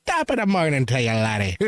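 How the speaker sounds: lightly put-on Irish lilt; mock Irish accent. Joking to co-host who likes accents, mock Irish accent. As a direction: play up an Irish greeting.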